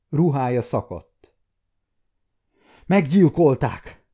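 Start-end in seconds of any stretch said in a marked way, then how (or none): 2.9-4.1 loud anguished cry, slower; shouted. A narrator's reading. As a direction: cry it out in horror. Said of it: "kiáltotta".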